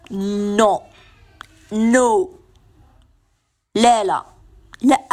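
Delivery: a short flat no, repeated; just say no and mean it; firm refusal. Shown as a demonstration. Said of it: "بقول كلمة لا". (رفض حازم وقصير)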